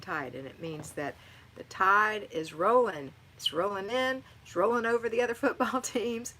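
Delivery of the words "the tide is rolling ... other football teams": rising, loud and fired up; a fan loudly bragging with a laugh; excited fan. Real, not acted. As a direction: cheer the team on like a proud fan. Hyping her team's war cry.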